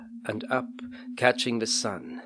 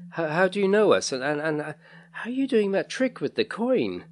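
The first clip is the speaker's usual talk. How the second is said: put-on higher, stammering boy in disbelief; baffled stammering; 8 semitones higher than usual. A narrator's reading. Baffled boy questions the man about the coin, flustered. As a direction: stumble over the questions, pitched up.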